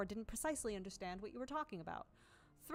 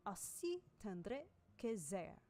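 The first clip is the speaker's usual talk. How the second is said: slow French phrase, aussi tendre que zer; French accent; much slower than usual. Shown as a demonstration. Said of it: "French alludes".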